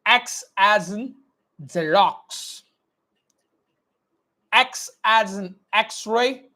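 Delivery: slow, clearly mouthed letter drill; teacher drilling phonetic alphabet; slow dictation. Shown as a demonstration. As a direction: model every sound slowly. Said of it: "Always focus on my lip movement".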